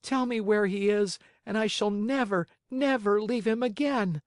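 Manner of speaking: put-on high, plaintive little-boy lament; high-pitched forlorn puppet, rising in distress; pleading puppet boy, grieving. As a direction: beg and lament in a high child voice. Pinocchio laments to dead fairy.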